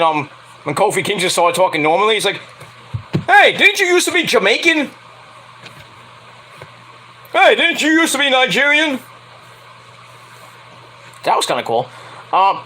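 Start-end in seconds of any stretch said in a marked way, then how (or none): 3.3-9.0 high, mock-puzzled questioning voice; Triple H. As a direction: call someone out in a raised, put-on voice. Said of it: "I'm thinking of Triple H when".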